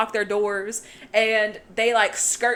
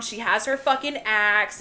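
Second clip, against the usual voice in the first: ordinary talk, nothing marked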